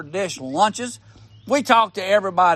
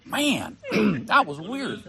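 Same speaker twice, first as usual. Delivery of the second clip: throat-clearing after swallowing a bug; just swallowed a bug; 5 dB quieter than usual. Real, not acted. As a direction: clear the throat through the words. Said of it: "I just swallowed a bug".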